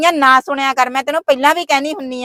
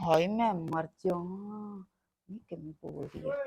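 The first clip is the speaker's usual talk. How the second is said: much lower and much softer, a stunned gasp; hushed shock; 7 semitones lower, 18 dB quieter than usual. Acted. Woman aghast at her sister-in-law's talk, hushed and stunned. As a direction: gasp it under your breath, aghast.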